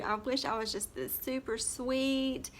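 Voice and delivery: normal talking voice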